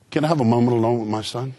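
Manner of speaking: low, mock-tender father's request; sweet gentle voice. Dad asking the vice principal, sweet gentle low voice. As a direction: imitate suspiciously gentle politeness. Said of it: "the sweetest, most gentlest, most beautiful voice".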